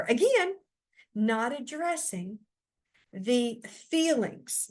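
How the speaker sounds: ordinary delivery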